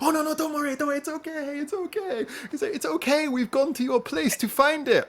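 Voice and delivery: very high, eager put-on voice; reassuring translator. Translator telling the detainee not to worry, high and eager. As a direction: chirp it out to calm him.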